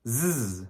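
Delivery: zzz as a long buzzing voiced Z. Shown as a demonstration. Teacher shows the voiced Z sound. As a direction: hum the zzz with voice on. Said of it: "once we add the voice onto it".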